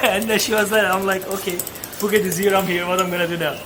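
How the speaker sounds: ordinary talk, nothing marked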